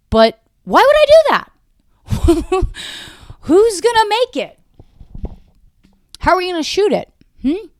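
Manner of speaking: mock the idea in a tiny cartoon voice; squeaky self-mockery. Mocking her own script idea.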